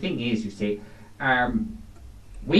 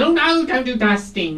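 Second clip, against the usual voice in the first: high, louder camp protest; camp refusal; 11 semitones higher, 5 dB louder than usual. Acted. Cleaner refusing a client's dusting, camp protest. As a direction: recoil from the chore in mock horror.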